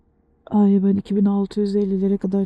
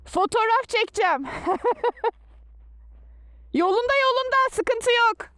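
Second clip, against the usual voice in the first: playful singsong; 12 semitones higher than usual. Real, not acted. Teasing herself on the cold pass.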